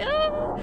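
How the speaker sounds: very high, sustained mimicked whine; imitated loud noise. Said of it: "It's going like".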